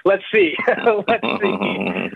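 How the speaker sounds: said through a chuckle; laughing. Real, not acted. Lawyer amused by a new associate's optimism, laughing. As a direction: break into laughter on the words.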